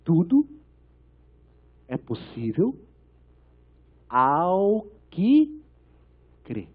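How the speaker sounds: baixo, pausado, palavra por palavra; resposta de Jesus. Retold or imitated. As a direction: resposta ponderada, com longas pausas. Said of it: "porque ele fala". (resposta de Jesus, pausada e contida)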